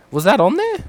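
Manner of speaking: high, loud startled question; sudden squeak of surprise at the list; surprised question. Real, not acted.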